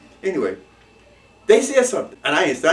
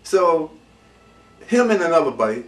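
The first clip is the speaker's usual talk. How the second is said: normal talking voice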